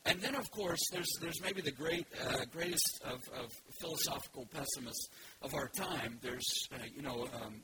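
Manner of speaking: ordinary delivery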